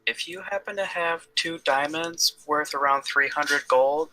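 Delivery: high-pitched put-on character voice; Delilah. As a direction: pitch it well up for a helpful offer. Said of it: "How'd you like my Delilah voice?".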